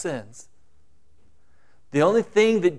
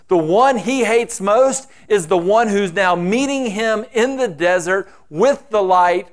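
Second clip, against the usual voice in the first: normal talking voice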